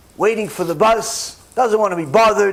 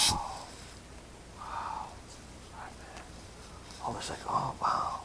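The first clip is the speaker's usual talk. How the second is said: much softer whispered oh wow; stunned hush; 21 dB quieter than usual. Acted. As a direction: breathe it out in shock.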